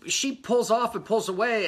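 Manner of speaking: ordinary talk, nothing marked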